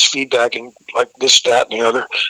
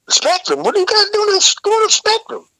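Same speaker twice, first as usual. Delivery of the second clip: high-pitched, incredulous driver imitation; mock astonishment, pitched way up; faster than usual. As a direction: squeal the questions in disbelief. Driver reacts to their destination.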